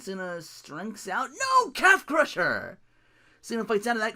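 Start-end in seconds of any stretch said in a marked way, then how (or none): 1.3-1.7 sudden, very high cry; shocked no. Real, not acted. Shocked reaction to a move. 1.7-2.8 excited call. Real, not acted.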